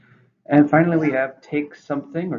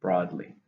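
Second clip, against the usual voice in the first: "Broadly" stressed on BROAD, falling; stress on first syllable; 4 semitones lower, 3 dB quieter than usual. Shown as a demonstration. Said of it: "Stress is on the first".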